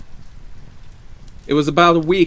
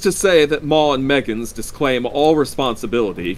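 raised-voice official messenger; firm's visitor; 6 semitones higher, 7 dB louder than usual. A narrator's reading. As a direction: deliver the company's statement, higher and louder.

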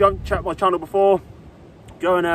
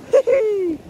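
happy laugh; 13 semitones higher, 3 dB louder than usual. Real, not acted. Laughing while landing fish.

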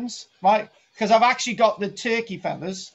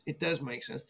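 much softer muttered does, falling; trailing-off repeat; quiet repeat; 6 semitones lower, 12 dB quieter than usual. Shown as a demonstration. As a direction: drop it almost under your breath. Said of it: "It, it, it".

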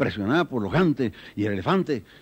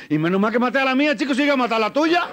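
cheeky Maracaibo man; 8 semitones higher than usual. Acted. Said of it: "se para el maracucho y dice".

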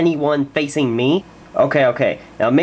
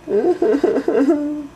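high put-on cackle; mocking laugh; 10 semitones higher than usual. Bully laughing at the others, high cackle. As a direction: laugh at the girlies.

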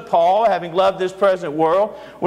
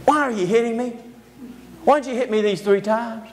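higher, lightly voiced donkey, gently hurt; a hint of a wounded animal pleading with its rider; talking donkey; 3 semitones higher than usual. Donkey asks the master who struck it, lightly voiced and hurt.

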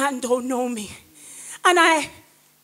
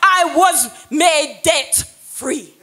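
loud, higher, ringing sermon voice; full-force preaching; 5 semitones higher, 4 dB louder than usual. Real, not acted. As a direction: preach it out to the whole church. Preaching testimony to the congregation.

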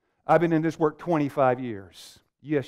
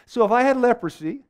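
higher, louder, driving challenge; preaching at full force; 9 semitones higher, 6 dB louder than usual. Acted. Preacher challenging the congregation, full force. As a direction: press the room hard for an answer.

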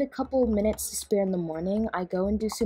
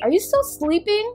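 high calling out; 11 semitones higher, 6 dB louder than usual. Acted. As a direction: call up high and loud. Mother at daughter's door.

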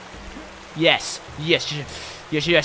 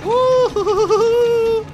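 high, loud, slow laughing whoop; whooping cheer; 6 dB louder than usual. Real, not acted. Gamer whooping after clearing a hard climb. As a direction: whoop in triumph.

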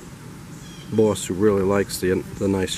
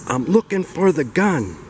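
high, projected announcement; loud yell; 8 semitones higher, 3 dB louder than usual. Acted. Husband yelling to his whispering wife, loud and high. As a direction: call it out high for all to hear. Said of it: "in a nice loud voice he yells".

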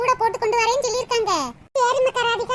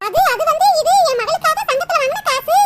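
flustered stammer; 4 semitones higher, 6 dB louder than usual. Acted. Mother caught, stammers excuse.

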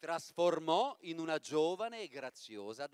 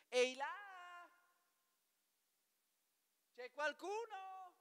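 much higher, slow, softer calling voice; 11 semitones higher, 7 dB quieter, slower than usual. Acted. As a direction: call into the empty kitchen. Papageno chiama nella cucina. (richiamo acuto e lento)

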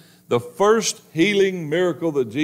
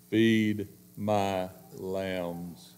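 slow, low, soft command; Jesus quietly telling Peter, slow and low; tender command; 6 semitones lower, 8 dB quieter, much slower than usual. Acted. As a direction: lay the words down gently and slowly.

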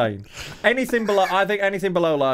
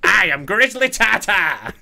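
campy drag queen; 4 semitones higher, 4 dB louder, slower than usual. Pretend. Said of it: "Doesn't grizzly tartar sound like a drag queen?".

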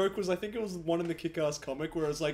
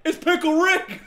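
excited catchphrase; 9 semitones higher, 8 dB louder, slower than usual. Pretend. Quoting the cartoon catchphrase.

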